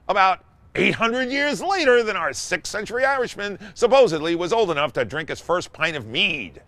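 normal talking voice

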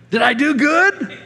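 high, drawn-out imitation of a proud kid; eager child. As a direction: ask like a kid bursting for praise. Proud grandson asking grandpa, acted.

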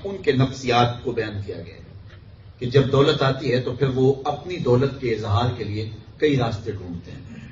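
usual speaking voice

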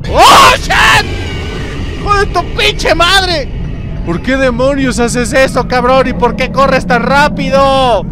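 high, loud shriek into angry yelling; startled screaming. Real, not acted. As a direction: scream in fright, then scold loudly. Jump scare, swears at the monster. (gritos de susto y reclamo)

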